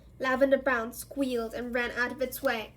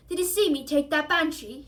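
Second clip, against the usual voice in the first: loud boastful shout; 3 semitones higher, 6 dB louder than usual. A narrator's reading. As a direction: brag to friends, loud. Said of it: "shouted Seamus".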